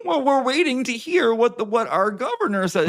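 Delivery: high, loud mock-earnest voice; mocking the obedient. Vlogger mocking people who waited for the governor, high and loud. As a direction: parody someone waiting for orders from above. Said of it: "everyone was like".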